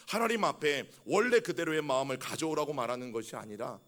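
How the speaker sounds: usual speaking voice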